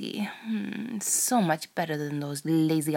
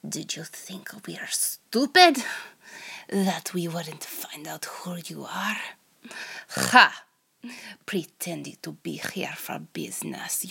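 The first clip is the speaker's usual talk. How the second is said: whispered Russian spy interrogator; hammed-up Russian accent, hushed start; over-the-top Russian accent. Interrogator taunting the captured American spy in a Russian accent. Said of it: "over-the-top Russian accent".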